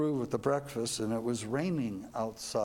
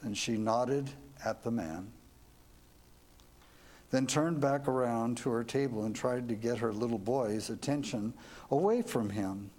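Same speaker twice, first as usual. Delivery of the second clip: ordinary delivery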